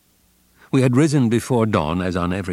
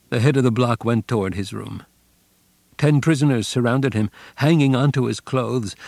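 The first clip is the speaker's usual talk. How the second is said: usual speaking voice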